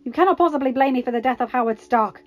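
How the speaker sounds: loud, heated denial; spat out. A narrator's reading. Karpov denying blame for the Starks' deaths, spitting out. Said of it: "Karpov spat out".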